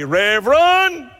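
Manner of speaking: wife crying out. Pretend. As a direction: call out the title like an upset wife. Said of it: "cry out and say".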